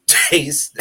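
ordinary talk, nothing marked

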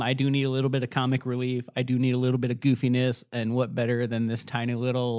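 ordinary talk

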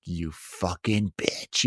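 low, slow grumbled insult under his breath; bitter mumble behind her back; muttered curse. Retold or imitated. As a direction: sulk it out quietly. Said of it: "he's like".

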